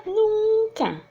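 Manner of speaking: Nunca high, first syllable held long, then dropping; emphatic, drawn-out "Nunca"; emphatic negation. Shown as a demonstration. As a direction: stretch the "nun" of Nunca up high. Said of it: "vários sinais que demonstram a negação".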